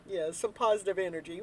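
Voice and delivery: ordinary talk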